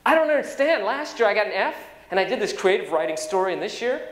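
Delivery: a hint of a confused schoolboy; imitating young boy. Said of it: "So Roy goes and he says".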